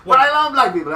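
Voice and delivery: high, very loud insistence; loud protest. Retold or imitated. Comic insisting to his audience, loud high protest. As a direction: burst out with the disclaimer.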